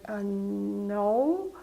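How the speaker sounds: hesitant, long drawn-out "no"; stammered reply. Retold or imitated. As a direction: retell it as an unsure stammer, stretching the word. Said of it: "and I kind of stammered".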